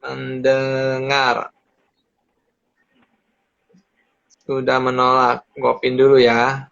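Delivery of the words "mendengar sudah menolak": slow, word by word while typing; slow dictation. Shown as a demonstration. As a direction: spell it out slowly for typing. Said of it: "Gua tuh ketik dulu ya".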